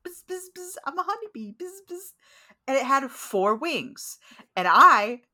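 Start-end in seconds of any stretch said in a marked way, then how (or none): 0.1-2.1 high, soft buzzing bee; sing-song like a kids' show bee; cartoon bee voice. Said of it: "You know".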